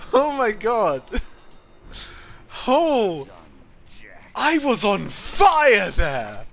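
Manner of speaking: laughing, high, excited cheering; giddy relief. Real, not acted. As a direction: laugh it out with joy. Victory after close fight.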